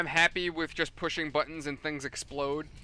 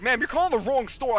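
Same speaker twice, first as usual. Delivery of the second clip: raised, louder and higher correction; retold yell; 7 semitones higher, 5 dB louder than usual. Store worker to a wrong-number customer, retold yell. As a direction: firmly correct an upset caller, voice up. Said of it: "I actually yelled at her".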